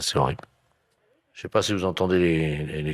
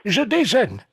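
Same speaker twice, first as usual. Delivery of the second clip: put-on old lady voice; 5 dB louder, much slower than usual. Prankster voices the seagull-eating lady.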